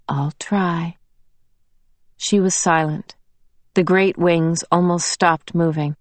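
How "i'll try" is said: soft whispered promise; whispered vow. Acted. Quiet vow.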